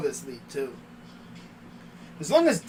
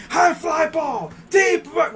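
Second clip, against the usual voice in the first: high, slow play-by-play shout; home run call; 9 semitones higher, 5 dB louder, slower than usual. Shown as a demonstration. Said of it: "my call is gonna be crazy".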